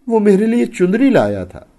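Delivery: high-pitched, loud distraught admission; tearful confession. A narrator's reading. Wife confessing about the gift of a shawl, tearful. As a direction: cry out the admission in acted distress.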